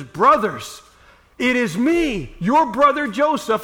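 loud, slow, high reveal; emotional reveal. Acted. Joseph to his brothers, loud and moved. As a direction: let it burst out of him.

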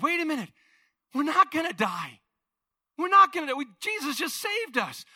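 high, loud, jubilant realization; joyful relief. Retold or imitated. Disciples realizing they survived the storm, overjoyed. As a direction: burst out with relief and joy.

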